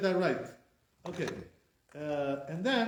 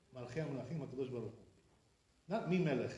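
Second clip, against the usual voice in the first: ordinary delivery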